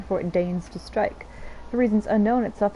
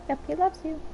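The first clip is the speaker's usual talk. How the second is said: high cutesy voice; 9 semitones higher, 4 dB quieter than usual. Real, not acted. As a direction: coo it high like to a pet. Cutesy voice to pet.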